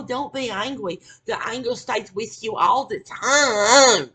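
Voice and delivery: high mock-preachy advice, last words dragged out; mocking imitation. Repeating others' advice not to be angry, mocking and drawn out. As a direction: mimic the advice, swelling to a drawn-out wail. Mimics advice against anger.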